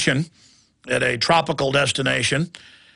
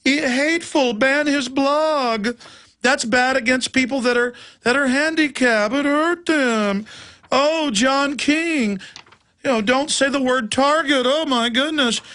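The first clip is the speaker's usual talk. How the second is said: high, prissy mock voice, mostly slowed; hammed-up whiny nanny-state voice; mock nanny scold; 7 semitones higher than usual. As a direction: lampoon a fussy, pearl-clutching censor. Mocking the ninny nanny state.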